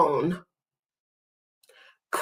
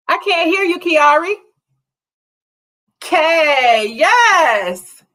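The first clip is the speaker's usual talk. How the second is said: excited praise; 7 semitones higher, slower than usual. Real, not acted. Cheering a pupil's answer.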